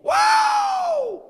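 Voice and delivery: very high, very loud holler; wild fan whoop. Retold or imitated. Imitating other people at the ball game, wild whoop. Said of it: "And there were other people".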